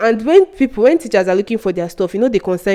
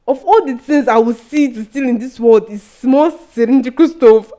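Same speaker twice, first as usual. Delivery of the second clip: loud, high scornful retort; talking back; 4 semitones higher, 5 dB louder than usual. Retold or imitated. Student defending herself to the accusing teacher, loud indignation. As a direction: protest with indignant emphasis, voice raised. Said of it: "when I talked back at her".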